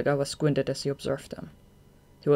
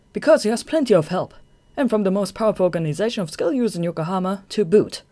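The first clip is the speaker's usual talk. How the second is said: louder, cocky; smug gloating; 5 semitones higher, 7 dB louder than usual. Acted. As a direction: brag with a grin. Tanizaki gloats.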